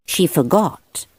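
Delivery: ordinary delivery, nothing marked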